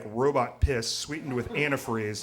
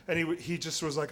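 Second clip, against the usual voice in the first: ordinary delivery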